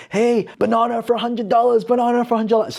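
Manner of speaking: calling out to the crowd. Retold or imitated. The speaker calls out to shoppers to draw a crowd, market holler. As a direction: shout it like you're selling the banana to the whole market. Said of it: "So that started to get people's attention".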